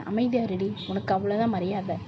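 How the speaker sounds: normal talking voice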